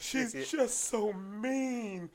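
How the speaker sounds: slow, high mock-weepy whine; imitated bawling. Huge guy complaining about the lady, mock weepy whine. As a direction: draw it out high like a crying complaint. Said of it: "this guy's balling".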